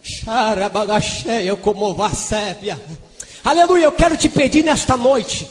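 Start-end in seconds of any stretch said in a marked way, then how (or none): 0.0-2.8 slow, softened glossolalia; speaking in tongues. Real, not acted. Preacher praying in tongues after citing scripture, speaking in tongues. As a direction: let slow prayer syllables fall away.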